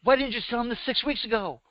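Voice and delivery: high-pitched, incredulous mom voice; exasperated mother. Retold or imitated. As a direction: imitate a mother demanding an explanation.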